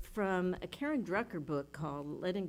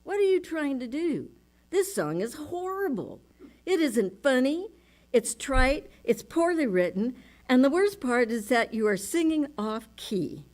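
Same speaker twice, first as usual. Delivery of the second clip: higher, louder sneering voice of self-doubt; mock the nagging critic in your head; harsh inner critic; 5 semitones higher, 8 dB louder than usual. The critic voice in her head mocking her singing, sneering. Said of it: "I hear this voice saying in my head".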